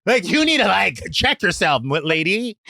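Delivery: high sassy scolding, lady; sassy telling-off. Retold or imitated. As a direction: put her in her place, sharp and high. Telling off the woman.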